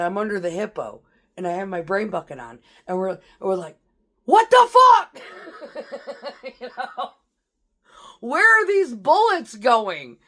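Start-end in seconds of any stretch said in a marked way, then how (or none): 4.2-5.2 high, full-volume startled yell; alarmed shout. Retold or imitated. Soldiers under the hippo as rounds come in, shouting. Acting out reaction under incoming fire. 5.2-6.6 high, quieter laugh after a shout. Real, not acted. Veteran telling of being under fire, laughing. 8.2-10.2 high, loud, slow-stretched yell; alarmed shout. Retold or imitated. Soldier under the hippo during incoming fire, shouting.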